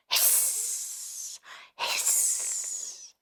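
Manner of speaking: whispered snake hiss. Shown as a demonstration. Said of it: "A snake goes like this".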